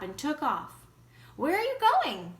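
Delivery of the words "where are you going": high, loud call after him; yelled. A narrator's reading. Daddy calling after his son, loud yell. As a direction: shout after the running boy. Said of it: "Daddy yelled after him".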